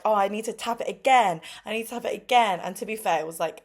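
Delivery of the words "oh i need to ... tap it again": put-on eager kid. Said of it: "he was like".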